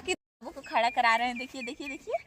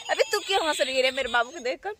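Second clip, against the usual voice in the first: ordinary talk, nothing marked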